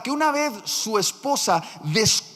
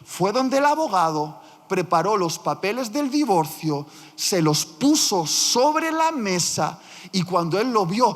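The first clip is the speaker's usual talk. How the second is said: ordinary delivery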